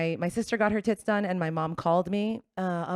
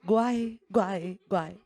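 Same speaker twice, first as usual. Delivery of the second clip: mock Mexican accent, slow and pleading; lightly accented Mexican mom, scolding her daughter; slower than usual. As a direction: mimic a heartbroken Mexican mom, slowed down. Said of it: "and she said".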